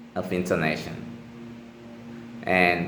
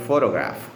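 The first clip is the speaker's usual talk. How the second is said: "photograph" with stress on the first syllable; faster than usual. Shown as a demonstration. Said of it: "the first syllable is stressed".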